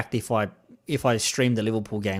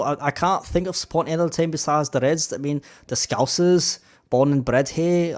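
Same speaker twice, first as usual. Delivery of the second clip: attempted Scouse accent, slightly raised. Shown as a demonstration. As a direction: play a die-hard Liverpool fan in Scouse. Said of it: "Can I do a Scouse accent?".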